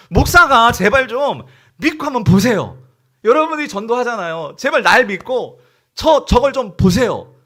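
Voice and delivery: loud, high, drawn-out plea; pleading shout. Acted. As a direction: beg them at full voice to believe and look. Pastor begging people to look. (제발 믿어 달라고 크게 애원)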